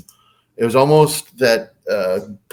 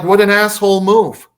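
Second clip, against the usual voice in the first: indignant thought; 9 semitones higher, 6 dB louder than usual. Retold or imitated. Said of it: "I'm thinking".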